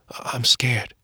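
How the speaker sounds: frightened breathy whisper, halting; scared whisper. Acted. Dying mother admits to husband she is frightened, whispering. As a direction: whisper the fear so no one hears. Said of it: "in a weak voice".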